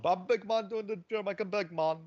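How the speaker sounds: high sing-song Jamaican patter; Jamaican big man. Retold or imitated. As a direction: chant it like a Jamaican singer. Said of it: "he's doing the singing of the song".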